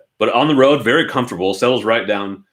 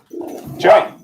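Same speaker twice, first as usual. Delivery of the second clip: sharp high call for Chewy; calling the dog; 12 semitones higher, 5 dB louder than usual. Real, not acted. Streamer in garage calls out to noisy dog, sharp call. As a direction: call the dog to stop barking.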